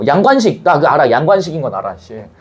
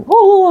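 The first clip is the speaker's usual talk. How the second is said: imitated sobbing; 13 semitones higher than usual. Teacher retelling a sea scene, imitating sobbing. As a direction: mimic a man sobbing out 어, 어. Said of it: "하면서 막 울고 있어".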